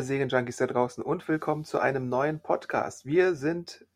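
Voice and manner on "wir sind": ordinary delivery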